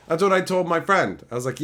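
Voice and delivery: usual speaking voice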